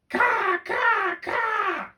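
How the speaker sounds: high, loud cawing call; raven caw. A narrator's reading. Second raven calling over the well, loud high caws. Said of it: "called the second raven".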